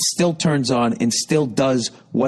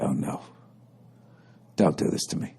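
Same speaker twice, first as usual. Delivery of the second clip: dread whisper; 6 semitones lower, 7 dB quieter than usual. Retold or imitated. Dread as clerk makes face.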